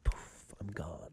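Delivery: whispered puff, then very low and soft; whispered vanishing. Retold or imitated. Man imagining himself disappearing, whispered and fading. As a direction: whisper it and fade away.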